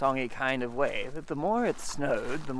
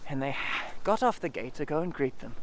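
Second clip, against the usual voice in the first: ordinary delivery, nothing marked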